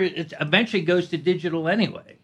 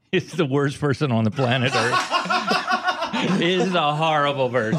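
laughing; slower than usual. Real, not acted. Comic rant amid shared laughter.

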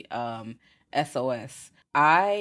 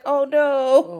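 higher and louder, a dismayed gasp of oh no; 10 semitones higher, 8 dB louder than usual. Retold or imitated. Viewer reacting to the movie, dismayed and higher. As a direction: react in alarm at the bucket. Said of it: "And I'm like".

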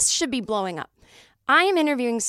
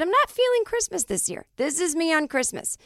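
high, loud mock defiance; a defiant anti-Christmas decree; defiant declaration; 6 semitones higher, 4 dB louder than usual. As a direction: announce it like a proclamation. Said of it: "where I said".

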